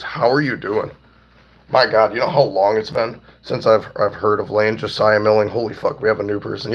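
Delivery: usual speaking voice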